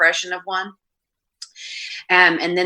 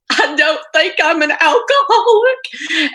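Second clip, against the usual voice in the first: high, tearful mock voice of the sister, louder; imitating crying sister; 13 semitones higher, 7 dB louder, slower than usual. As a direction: pitch way up like she's weeping on the phone. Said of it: "and she was crying".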